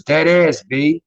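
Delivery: lightly voiced New York slang, punched up. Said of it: "then you got New Yorkers".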